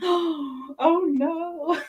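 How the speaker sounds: a teacher's horrified "oh no", lightly acted; imitated dismayed teacher. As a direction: play the dismayed teacher in your head. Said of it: "I had my teacher saying".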